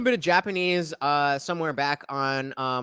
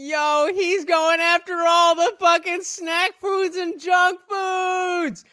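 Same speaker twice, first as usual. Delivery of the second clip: much higher, louder, slow hyped yell; excited shout; 12 semitones higher, 4 dB louder, slower than usual. Real, not acted. Streamer hyped by a video roasting snack food founders, excited shout. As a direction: cheer the roast on at full volume.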